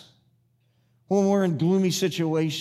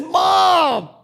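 high, loud, drawn-out call; exasperated shout; 13 semitones higher, 8 dB louder than usual. Retold or imitated. Son interrupts mother who keeps talking, exasperated shout. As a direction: cut her off with a loud, exasperated cry.

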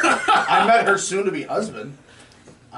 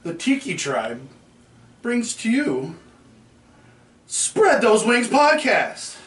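ringmaster; 2 semitones higher, slower than usual. Acted. Podcast intro, ringmaster opening line.

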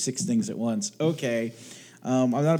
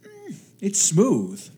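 slow, higher, appreciative; savoring a sip; 8 semitones higher, 4 dB louder, much slower than usual. Real, not acted. Host after first sip of whiskey, slow appreciative voice. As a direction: let the taste linger.

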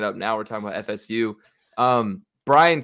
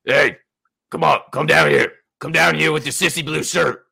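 louder, challenging coach voice; gruff coach comeback; 3 semitones higher, 7 dB louder than usual. Retold or imitated. Coach shouting back at a heckler, challenging. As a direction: call him down, daring him. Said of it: "he responds by saying".

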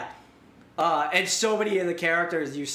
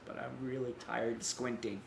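quiet weary line; 3 semitones lower, 9 dB quieter than usual. Retold or imitated. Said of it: "and she starts crying".

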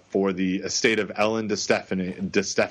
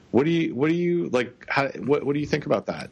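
higher, incredulous questioning; skeptical voice; 7 semitones higher than usual. Real, not acted. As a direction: ask it like you doubt it. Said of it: "skeptical voice, uh, that I just put on".

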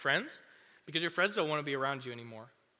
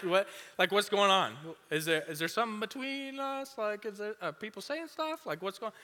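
higher, fretful put-on voice; a high, mock-worried teen asking what's wrong; anxious friend; 6 semitones higher than usual. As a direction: sound nervous and insecure, pitched up.

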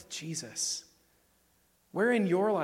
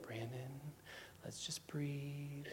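soft, slow whispered calm voice; mock calm person; 14 dB quieter, slower than usual. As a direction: imitate a soothing calm friend. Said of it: "the people who are like".

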